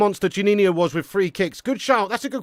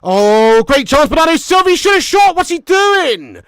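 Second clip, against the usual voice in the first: high, loud outcry; exasperated commentary; 10 semitones higher, 11 dB louder than usual. Real, not acted. Fan watching a wasted chance, exasperated shouting. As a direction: soar into a shouted, drawn-out complaint.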